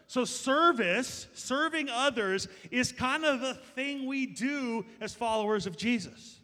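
slow and high, every word weighed; slow emphatic preaching. Real, not acted. Pastor to his church, slow and lifted emphasis. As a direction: spell it out slowly for the congregation.

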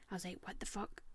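hushed shocked whisper; whispered shock at glass. Retold or imitated. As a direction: breathe it in disbelief. Finding glass in bed.

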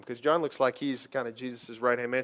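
ordinary talk, nothing marked

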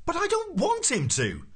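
high, frantic outburst; exasperated protest. A narrator's reading. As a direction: protest in high-pitched frustration. Arthur protests at Ford's suggestion.

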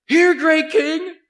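sarcastic feast call, pitched high and loud; mock royal toast. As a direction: hail him like a jeering courtier. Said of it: "as a mockery".

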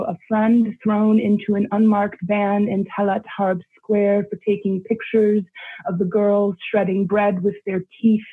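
ordinary talk, nothing marked

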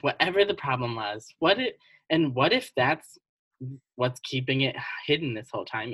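ordinary delivery

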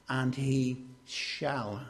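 SHALL drawn out slowly and set apart; slow, deliberate weight on SHALL; stress on SHALL. Shown as a demonstration. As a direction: lean long and firmly on the word shall. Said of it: "I'm putting emphasis on that word".

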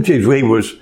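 ordinary delivery

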